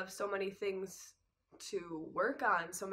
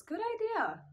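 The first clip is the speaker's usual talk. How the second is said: very high, sing-out mom impression; mock-bright mom. Mom approving the plan to tell Dad, high chirped mimicry. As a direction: imitate mom chiming in, pitched way up.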